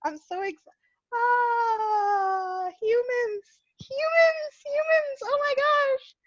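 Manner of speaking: very high, strained mock disbelief; mock exasperation. Retelling her unspoken reply to the rude stranger, high and exasperated. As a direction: throw up your hands at humans. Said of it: "but I was like".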